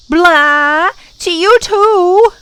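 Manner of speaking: put-on high, loud childish comeback; yelling back. A narrator's reading. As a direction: yell it back, dragging it out. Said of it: "yelled back".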